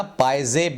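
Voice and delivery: पायजेब as "paai-zeb", with z; accepted variant. Shown as a demonstration. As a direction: glide to a y before the z in पायजेब. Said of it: "भी बोल सकते हैं".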